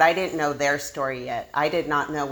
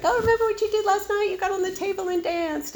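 far higher, fast giggly friend voice; cute gossip. Retold or imitated. Friend teasing about cute drunken antics, giggly high voice. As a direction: chirp it like fun gossip. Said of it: "blackouts were a little bit cuter".

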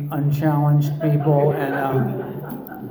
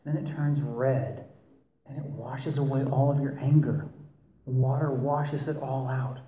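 imitating guided meditation voice; 8 dB quieter than usual. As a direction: lull them like a therapist leading a meditation. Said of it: "modulate your voice like that and talk like this".

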